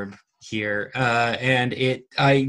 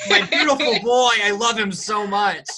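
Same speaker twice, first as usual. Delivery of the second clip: doting gushing; 9 semitones higher than usual. Pretend. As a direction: gush like a smitten parent. Mocking doting over Edward.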